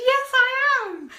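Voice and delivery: high, loud, drawn-out retold squeal; thrilled. Fan recognised by Pat, replying with a thrilled squeal. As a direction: squeal it proudly, stretching the words.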